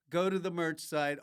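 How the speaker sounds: ordinary delivery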